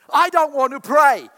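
high, loud groaning whine about church; hammed-up lazy complaint; loud mock groan. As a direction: moan it like a reluctant churchgoer. Mock reluctant believer.

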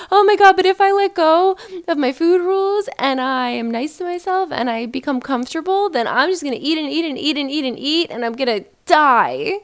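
high, loud hammed-up panic; mock the anxious dieter, shrill with dread; a hammed-up voice of an anxious dieter, pitched high and loud; panicked mock whine. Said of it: "That's what you sound like".

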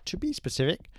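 ordinary talk

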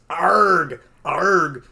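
loud pained arg arg; pained yells. Acted. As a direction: cry out as the wolves bite. Jonah bitten by wolves.

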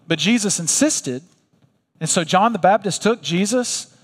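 ordinary talk, nothing marked

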